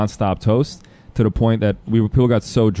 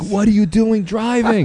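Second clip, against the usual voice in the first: high, slow, disbelieving question; mock-outraged disbelief; a much higher, drawn-out what are you doing; incredulous; 12 semitones higher than usual. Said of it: "I'm like".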